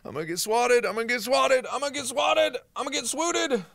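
high-pitched panicky chant; mock panic. Real, not acted. Mock panic, about to be swatted.

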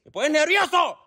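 high, irritated outburst; worked-up exasperation. Acted. Instructor losing patience with pupil, exasperated. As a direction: voice climbing with irritation.

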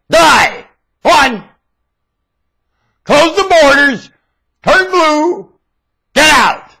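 sudden high yelled curse; shout. Real, not acted. Ranting at Egypt, a furious shout. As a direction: bark it out in fury.

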